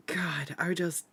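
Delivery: exasperated whisper, slowed. Real, not acted. Exasperated over a broken coffee pot, whispered. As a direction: breathe it out in frustration.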